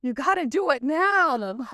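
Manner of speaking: high, drawn-out retold demand; urgent plea. Retelling her urgent plea to God.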